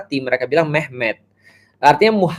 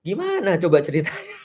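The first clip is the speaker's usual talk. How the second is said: laughing; 5 semitones higher, 5 dB quieter than usual. Real, not acted. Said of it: "laughs at own joke".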